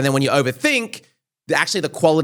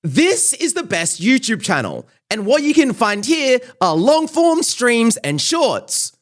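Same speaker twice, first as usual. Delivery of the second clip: high, slow, stretched-out channel intro; YouTube Shorts intro voice; 8 semitones higher, slower than usual. Shown as a demonstration. Guest plugs the channel on request in a put-on intro voice. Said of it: "my intro voice is so around certain phrases".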